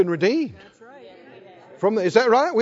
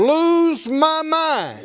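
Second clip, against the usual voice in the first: slow, raised-pitch proclamation; out loud, like you mean it; 7 semitones higher, 5 dB louder, much slower than usual. Shown as a demonstration. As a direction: lead the congregation with drawn-out conviction. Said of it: "say it like you mean it".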